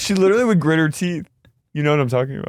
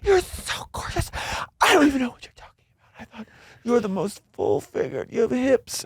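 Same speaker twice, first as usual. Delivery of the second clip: high breathless whisper, much softer; mock gushing Heather; 7 semitones higher, 5 dB quieter than usual. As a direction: gush it in a hushed high whisper. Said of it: "She goes".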